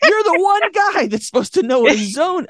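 very high-pitched mock disbelief; squeaky, aghast retelling; incredulous players. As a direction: imitate stunned kids questioning their coach. Players' disbelief at clueless coach.

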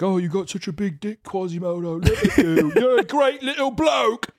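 hammed-up mock flattery; mocking crowd voice. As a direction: taunt him like a jeering crowd. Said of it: "they're like".

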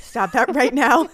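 put-on slow, prim British accent; mock British accent. Wife telling husband to stop, in a put-on British accent. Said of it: "what accent was that?".

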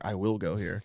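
low, soft hypnotic command; mock vampire glamour. Friend showing how vampires glamour people, low and hypnotic. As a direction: stare them down and will it. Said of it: "how vampires glamour people".

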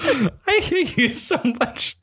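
high, slow, helpless laughing. Real, not acted. Husband teasingly giving up on his wife, laughing. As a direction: drag it out high through laughter.

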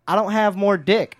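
ordinary talk

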